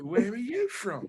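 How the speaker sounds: repeating it with a laugh in the voice; amused mock repeat. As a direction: ask again through a chuckle. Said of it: "ability to mimic people's talking".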